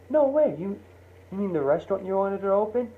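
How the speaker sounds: high, fast cry of disbelief; can't believe what he hears; amazed. Retold or imitated. Mike hears John's restaurant plan.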